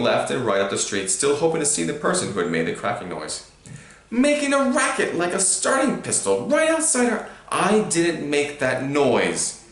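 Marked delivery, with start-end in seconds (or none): 4.1-7.3 much higher, indignant uncle voice; high furious rant. A narrator's reading. 7.5-9.6 slow, firm denial; boy firmly denying to his uncle. A narrator's reading. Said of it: "said Harry firmly".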